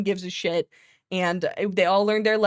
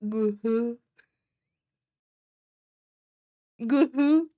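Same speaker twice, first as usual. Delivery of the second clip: deadpan creature voice; lightly voiced big pterodactyl; pterodactyl line; much slower than usual. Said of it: "He goes".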